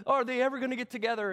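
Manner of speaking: quick, high, loud burst of anticipation; mock suspense. Viewer of a love story wondering about the couple, eager. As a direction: gush it like an impatient romance fan.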